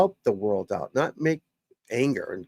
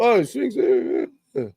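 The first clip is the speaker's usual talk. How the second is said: high, garbled mock-complainer voice; mocking critics; 8 semitones higher than usual. Coach mimicking people who criticize his swing, mocking. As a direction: imitate a whiner dissolving into noises.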